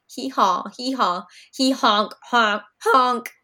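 high, loud braying hee-haw; donkey honk. A narrator's reading. Young peacock fuming at his honking voice. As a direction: bray it like an angry donkey. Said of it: "Fuzby fumed".

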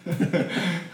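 high, soft chuckle; a brief, quiet laugh to yourself; short giggle. Real, not acted. Storyteller giggling at her own gas station tale, short giggle.

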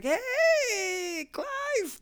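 very high, drawn-out greeting; over-friendly stranger. Retold or imitated. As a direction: squeal hello as a long-lost pal. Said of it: "come up to you and be like".

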